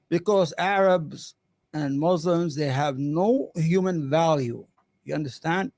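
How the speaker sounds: ordinary delivery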